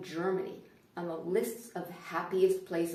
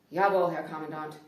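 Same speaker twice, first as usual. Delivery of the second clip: mock obedience; 4 semitones lower, 6 dB louder than usual. Mock obedience to instructing cashier.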